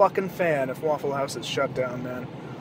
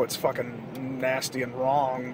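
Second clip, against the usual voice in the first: ordinary talk, nothing marked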